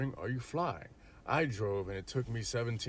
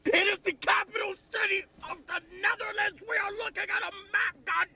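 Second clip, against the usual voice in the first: much higher, louder yelling; exasperated shout; 4 dB louder than usual. Real, not acted. Shouting at a friend who doesn't know Amsterdam, much higher and louder. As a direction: blow up in disbelief.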